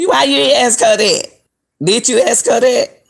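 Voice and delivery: high, loud laughing question; laughing disbelief. Real, not acted. Vlogger questioning the friend with a laugh, high and loud.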